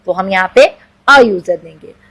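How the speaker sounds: ordinary talk, nothing marked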